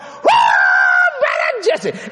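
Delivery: very high, drawn-out whoa; over-the-top shriek of greeting; excited mock holler. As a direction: whoop it out sky-high like an excited woman. Acting out an excited woman calling him.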